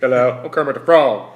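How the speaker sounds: very loud puppet-frog attempt; Kermit the Frog. Shown as a demonstration. Failed try among friends, loud Kermit voice. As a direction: blurt out a frog impression. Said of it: "See if I can do it".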